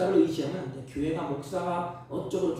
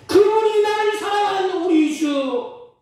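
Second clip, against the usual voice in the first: loud, higher, slow proclamation; full-force preaching; 11 dB louder, slower than usual. Acted. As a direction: proclaim it to the whole church. Preaching climax.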